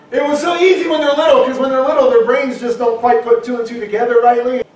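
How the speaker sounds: normal talking voice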